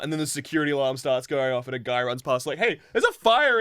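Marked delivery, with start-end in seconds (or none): 2.6-3.6 high, loud acted warning; mock-urgent yell; alarmed shout. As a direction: call out a fire alarm in passing. Passer-by raises the alarm.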